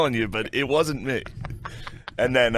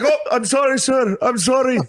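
high, panicky mock apology; flustered apology. Caught in the act, apologizing to sir, flustered. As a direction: imitate a flustered apologizer in a much higher voice.

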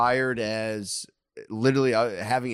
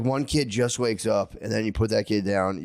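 ordinary talk, nothing marked

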